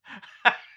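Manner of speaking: high, loud laugh; burst of laughter. Real, not acted. Seeing the flannel baby image, a sudden high laugh. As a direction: crack up at the picture.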